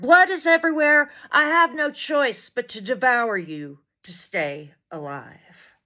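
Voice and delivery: usual speaking voice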